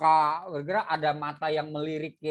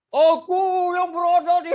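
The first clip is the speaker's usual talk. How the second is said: shouted much higher and louder; wrong shouted reading; 10 semitones higher, 8 dB louder than usual. Shown as a demonstration. Teacher demonstrating to students the wrong way, shouted high and loud. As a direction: belt it out as the bad example.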